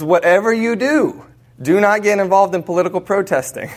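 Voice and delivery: high-pitched, emphatic quoted warning. Retold or imitated. Quoting the cardinal mission rule.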